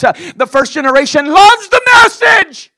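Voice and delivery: high, loud hollered line; preaching shout. Acted. Preacher hollering the point to the church. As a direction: shout it to the back pew.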